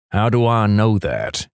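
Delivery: slow, suspicious cop question; an understated accusing tone. Acted. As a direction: ask it slowly, like an accusation. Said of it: "he asks accusingly".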